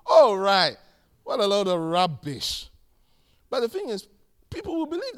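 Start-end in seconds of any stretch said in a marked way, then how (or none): 0.1-2.7 loud burst of disdain; emphatic, contemptuous outburst; scornful dismissal. Real, not acted. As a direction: dismiss it loudly as nonsense. Scornful reaction to apostle's excuse.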